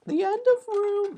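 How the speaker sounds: squeaky high announcer voice; a goofy, very high fake start; high squeaky mock voice; silly voice. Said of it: "Let me get my real voice".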